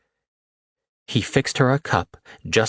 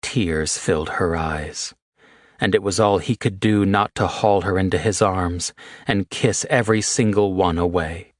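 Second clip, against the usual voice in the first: ordinary talk, nothing marked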